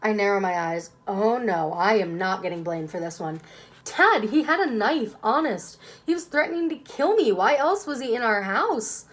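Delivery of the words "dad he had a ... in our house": high, loud desperate pleading. Acted. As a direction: beg him to believe. Pleading protest to dad.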